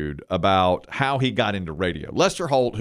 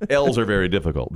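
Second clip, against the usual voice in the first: ordinary delivery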